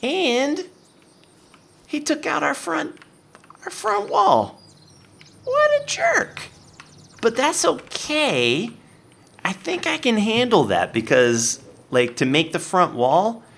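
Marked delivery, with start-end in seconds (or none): 0.0-10.2 mock indignation. Player complaining the zombie broke his front wall, high mock indignation. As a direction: put on a high indignant whine.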